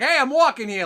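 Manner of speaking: put-on loud, high New York accent; brash New York street yell. Elf from New York telling someone off, New York accent. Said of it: "talk like they're from New York".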